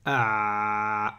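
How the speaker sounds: deep strained throat "ah"; voiced throat sound. Shown as a demonstration. Teacher demonstrating vibration in the throat. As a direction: let the throat buzz. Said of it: "Et ici, ça vibre".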